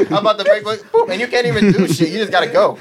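high-pitched talk broken by laughter; real laughing. Real, not acted. Joking about pushy New York drivers, laughing. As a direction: crack up while you say it.

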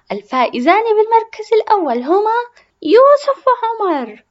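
loud, high, slowed announcer call; announcing the winners. Acted. Ruwaida announces the winners loudly like an announcer. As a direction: proclaim the winners' names.

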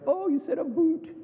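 high, teasing imitation of Canadian speech; mock Canadian accent. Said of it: "knew we were Canadians by our accent".

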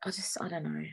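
drops to a breathy whisper, lost for words; whispered trailing off. Real, not acted. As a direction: give up mid-thought in a whisper.

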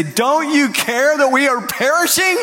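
high, desperate plea to the master; panicked cry. Retold or imitated. Disciples crying out to Jesus in the storm, panicked. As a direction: cry out in fear over the storm.

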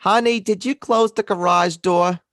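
loud, high-pitched Long Island spouse; exaggerated Long Island accent. Spouse calling about the garage, exaggerated Long Island accent. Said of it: "your exaggerated Long Island accent sounds part Boston".

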